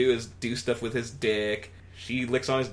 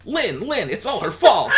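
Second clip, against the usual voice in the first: high, louder comic Alf; Alf blaming; 10 semitones higher, 7 dB louder than usual. Acted. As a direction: shift the blame. Alf voiced.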